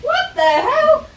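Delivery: high, loud, slow what the hell; shocked mock shout. The dog caught out by her, high shocked shout. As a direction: shriek it as the startled dog. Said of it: "And he went".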